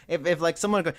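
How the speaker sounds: ordinary delivery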